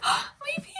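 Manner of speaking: high squealing cry of delight; overjoyed squeal at spotting a hijabi author; gushing. Acted. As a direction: squeal it like you found family.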